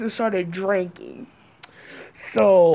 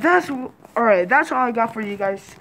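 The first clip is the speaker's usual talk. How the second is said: ordinary talk, nothing marked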